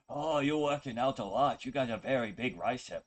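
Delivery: imitating a broken-English accent; mock broken-English accent. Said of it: "They wouldn't even say it like that".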